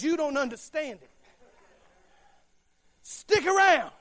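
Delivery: fiery preaching. Acted. Preacher exalting God over football titles, rousing loud preaching. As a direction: preach it with building fervour.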